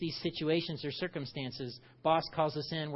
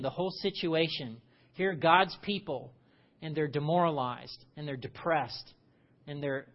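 usual speaking voice

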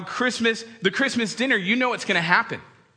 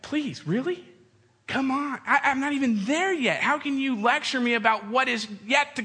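higher, pleading mock protest; exasperated protest; 6 semitones higher than usual. Grown child protesting a parent's lecture, higher and exasperated. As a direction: whine back at the lecturing parent.

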